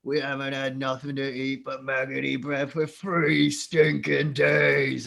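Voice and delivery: loud, slow orc whine, building. Acted. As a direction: stretch out the complaint, building to a loud finish. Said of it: "one of the Orcai goes".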